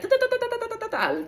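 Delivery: high, rapid-fire da-da-da babble; rapid writing noise. Retold or imitated. Showing viewers how the automatic writing came quickly, high rapid babble. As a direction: imitate frantic scribbling in a high monotone patter. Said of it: "automatic writing quickly, like".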